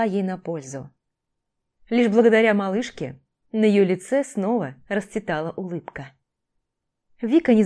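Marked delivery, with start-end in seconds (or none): none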